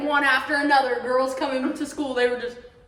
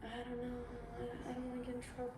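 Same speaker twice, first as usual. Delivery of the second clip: timid whisper; 19 dB quieter, much faster than usual. Retold or imitated. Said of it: "Linda's like".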